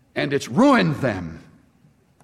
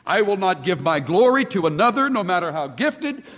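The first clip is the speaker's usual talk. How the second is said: building sermon crescendo; 5 semitones higher, faster than usual. Acted. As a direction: preach it, climbing higher line by line.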